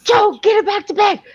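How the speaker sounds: very high, panicky mock shriek; squealed panicky order; panicked parent. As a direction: send a kid off in acted-out panic. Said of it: "I, I panicked".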